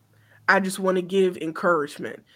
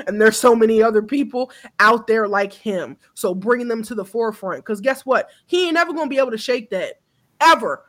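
ordinary talk, nothing marked

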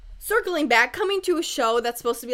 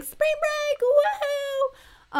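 mock party cheer; 4 dB louder, much slower than usual. Mock spring break party cheer.

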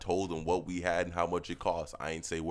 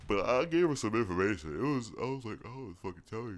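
yawning; 2 semitones higher than usual. Real, not acted. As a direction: talk through a yawn.

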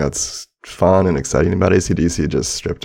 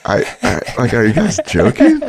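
holding back laughter; fighting off a giggle; stifled giggles; 5 semitones higher than usual. Real, not acted. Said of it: "I'm trying not to laugh".

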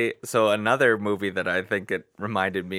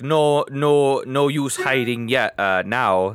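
put-on Irish accent; 5 semitones higher, 4 dB louder, slower than usual. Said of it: "do I sound like a pirate again".

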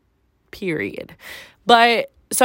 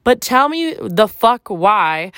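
ordinary talk

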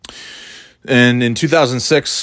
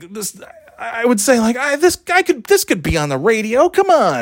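excited self-quote; 13 semitones higher than usual. Retold or imitated. Retelling his own earlier hype.

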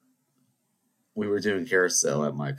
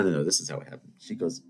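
ordinary talk

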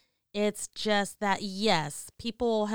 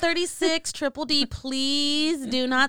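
mock announcement; 4 semitones higher, 6 dB louder than usual. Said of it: "and I'm like".

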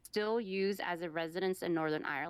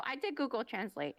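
ordinary delivery

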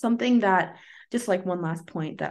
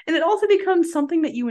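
laughing; 9 semitones higher, 5 dB louder, faster than usual. Real, not acted. Laughing while speaking.

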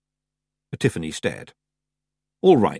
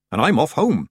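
sniffy; 5 semitones higher, 3 dB louder than usual. A narrator's reading. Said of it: "she sniffed".

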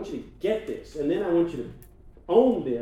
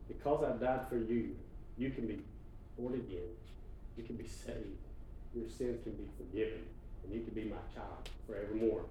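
softer, gentle voice of God; tender; 3 semitones lower, 8 dB quieter than usual. Acted. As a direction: reassure them softly. God's voice speaking to you.

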